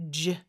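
G as a short "j" sound, as ending "change"; isolated demo of the G sound; soft G sound. Shown as a demonstration. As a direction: say G as the buzzed "dge" ending. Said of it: "요런 소리고요".

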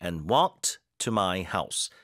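slow model phrase. Shown as a demonstration. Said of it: "뒤에 힘 주고".

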